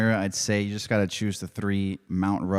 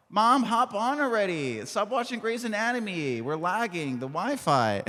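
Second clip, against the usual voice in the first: put-on high kid voice; 11 semitones higher than usual. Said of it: "I'm like".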